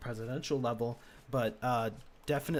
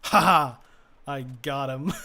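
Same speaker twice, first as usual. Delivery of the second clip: sudden loud gleeful game cheer, much louder; triumphant whoop; 13 dB louder than usual. Real, not acted. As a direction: burst out celebrating the kill. Scores a hit in game.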